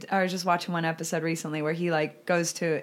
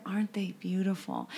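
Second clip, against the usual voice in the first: mock admirer; 6 dB quieter than usual. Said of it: "people are like".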